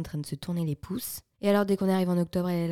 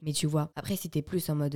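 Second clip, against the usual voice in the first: ordinary delivery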